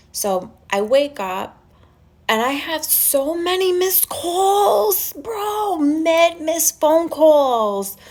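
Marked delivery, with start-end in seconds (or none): 2.3-8.0 high, drawn-out incredulous wail; exasperated exaggeration. Telling followers of all the missed calls, exasperated. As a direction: stretch the words high in mock outrage.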